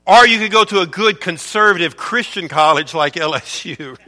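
ordinary delivery

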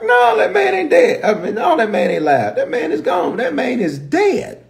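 high-pitched, hammed-up denial; emphatic denial. Joab telling David the man is gone, emphatic denial. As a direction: blurt it out in comic disbelief. Joab's blunt answer, acted.

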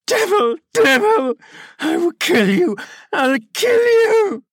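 berserk rage. A narrator's reading. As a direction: shriek like a boy gone mad. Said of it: "he rather shrieked than said".

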